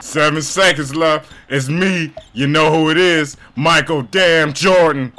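Michael Jordan. Pretend. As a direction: stretch out the name like a trash-talking legend. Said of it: "that's my interpretation of Michael Jordan".

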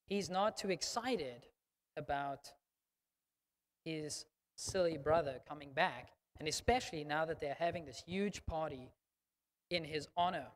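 ordinary talk

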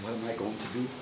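soft, low bewildered question to himself; puzzled muttering. Acted. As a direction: wonder it quietly under your breath. Chief scratches his head, baffled.

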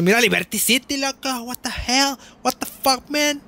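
high-pitched, comic Mexican-accented English; over-the-top Mexican accent for laughs; exaggerated Mexican accent. Shown as a demonstration. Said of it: "a exagerarle. Porque también se me hace que es gracioso".

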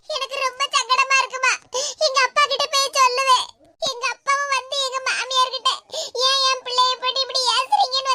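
sobbing between phrases; tearful, monotone lament; crying. Acted. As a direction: pour out the hurt through tears, gasping at the pauses. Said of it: "அழுதுகிட்டு இருக்க கூடாது".

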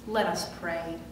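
ordinary talk

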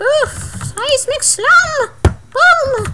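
high, drawn-out battle cry; hyped attack call. Pretend. Player calling a seismic slam in the game, hyped high call. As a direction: sing out the move in a high, stretched voice.

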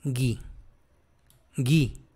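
"gui" said ghee, u silent; hard G. Shown as a demonstration. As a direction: say "gui" with hard g, low and soft. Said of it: "se pronuncia".

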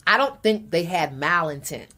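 ordinary delivery

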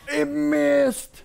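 high, loud shriek of disbelief; real panicked high yell. Real, not acted. Frantic reaction to a miss.

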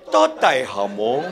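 low, soft, slow mock-Chinese voice; mock Chinese diner. Chinese diner answers the waiter, low slow mock-Chinese voice. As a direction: order low and slowly in a caricature accent.

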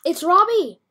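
loud, high, thrilled cry; excited discovery. Real, not acted. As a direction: exclaim it like a fan spotting a favorite. Finds the original Robbie, exclaims.

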